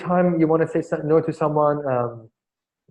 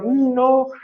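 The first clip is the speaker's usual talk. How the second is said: much higher, mock-whiny insisting voice; demanding brat; 8 semitones higher than usual. Trainer mimicking himself demanding from the receptionist, mock whiny. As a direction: play a spoilt child who won't take no. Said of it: "I was like".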